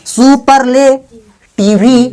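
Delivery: सुपरलेटिवी drawn out with a long "vee" ending; slow mock misreading, सु-पर-ले-टि-वी; wrongly voiced final E. Shown as a demonstration. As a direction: sound the silent E in सुपरलेटिवी, very slowly. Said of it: "अगर ई silent नहीं रहेगा तो इसको ये पढ़ेंगे".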